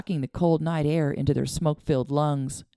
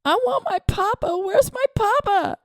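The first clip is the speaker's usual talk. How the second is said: wailing; 4 dB louder than usual. A narrator's reading. Boy wails for his father, high and loud. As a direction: cry out for his papa. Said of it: "Charlie sat up and wailed".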